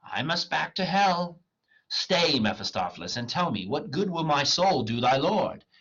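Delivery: reading a scene aloud with mild character voices; dramatic play reading. Acted. Teacher reading Faustus to the class, lightly acted. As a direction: read it like a stage script for class, lightly acted.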